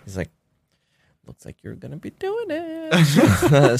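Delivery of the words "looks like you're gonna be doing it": high, drawn-out singsong; lilting mock-trainer voice; teasing sing-song. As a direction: stretch it out high, teasing the victim. Trainer breaks unwelcome news, teasing.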